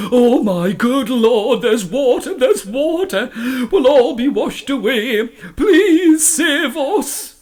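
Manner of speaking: high, wailing fake prayer with laughter; mock praying. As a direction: ham up a terrified prayer. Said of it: "started to pretend that he was praying".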